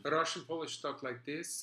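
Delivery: a hint of a Russian decorator's accent. Demoing his Russian accent for a fellow actor. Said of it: "Let me hear your Russian".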